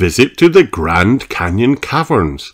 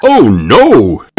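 loud, higher alarmed outburst; Super Potato's shout; 4 semitones higher, 6 dB louder than usual. A narrator's reading. Super Potato sees the space carrots, shouting alarmed. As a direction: shout in hero's dismay. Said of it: "shouted Super Potato".

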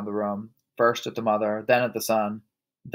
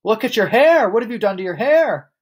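high, loud horrified shriek; 10 semitones higher, 6 dB louder than usual. A narrator's reading. Mother horrified by her husband's hair, shrieking. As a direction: let the pitch soar on each hair. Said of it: "the mother shrieked".

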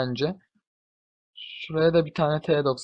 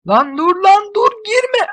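much higher, slow "Lan dur lan dur girme"; panicked high shout; 6 dB louder, slower than usual. Real, not acted. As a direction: scream at them to stop. (panik içinde tiz bağırış)

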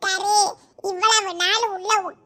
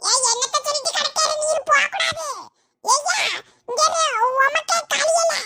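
shouting order; 6 semitones higher than usual. Acted. Wife shouts at husband to stay.

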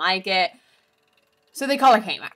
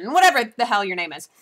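ordinary talk

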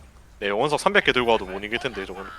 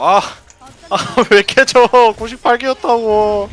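high, loud, slow complaining cry; frustrated outcry; 12 semitones higher, 11 dB louder, much slower than usual. Real, not acted. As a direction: wail at the loss. Loses stacks, frustrated.

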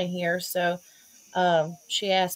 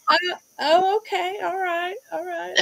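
awkward surprise; 9 semitones higher than usual. Retold or imitated. Awkward reaction retold.

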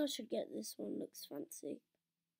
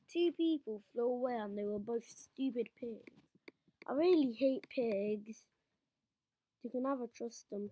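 normal talking voice

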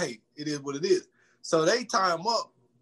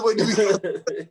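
higher, louder, mock-timid question; meek backpedal; 3 semitones higher, 4 dB louder, faster than usual. Chris Tucker meekly backing down on the bus. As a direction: play the suddenly polite coward. Retold meek reaction, repeated.

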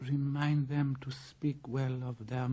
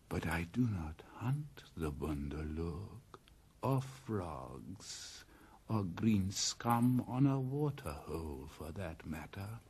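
low, unvoiced, disdainful hiss; scornful snake; 6 semitones lower, 5 dB quieter than usual. Python Kaa scorns the monkey folk, hissed low. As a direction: sneer it under the breath.

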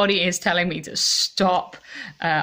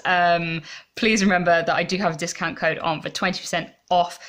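ordinary delivery

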